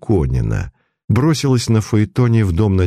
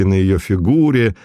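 usual speaking voice

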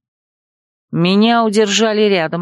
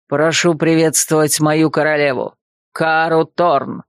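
put-on lower, composed older man; lower male voice; 4 semitones lower than usual. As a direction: voice him lower and calm. Andre names his queen.

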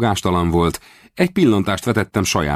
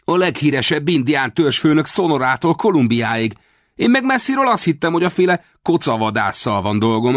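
put-on high, eager boy's voice; boy gushing over the famous chief; lively boy; 7 semitones higher than usual. A narrator's reading. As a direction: rattle on excitedly like an impressed youngster. Boy admiring, then teasing.

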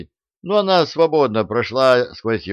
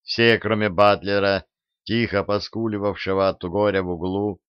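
ordinary delivery, nothing marked

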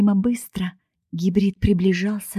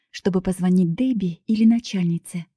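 usual speaking voice

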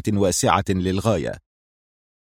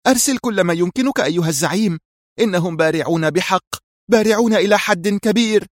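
much higher, anxious plea; tense; 10 semitones higher than usual. A narrator's reading. Mira urging her boss to send all he can, tense. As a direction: beg for everything he can send. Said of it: "قالت في توتر".

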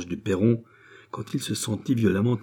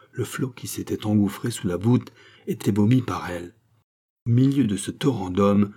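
usual speaking voice

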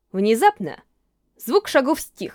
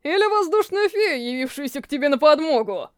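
mocking. A narrator's reading. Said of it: "насмешливо улыбнулся".

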